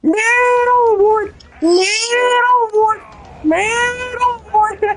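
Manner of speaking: slow, high drawn-out call; man overboard shout. Acted. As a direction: wail it out long and high. Calling out in game.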